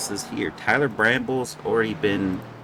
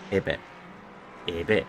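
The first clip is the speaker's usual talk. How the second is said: lightly French-accented name, mock Gallic try; 3 semitones lower, 5 dB quieter, faster than usual. Shown as a demonstration. Commentator trying the name in a mock French accent. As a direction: say it like a French person, playfully. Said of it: "If I say it like a French person".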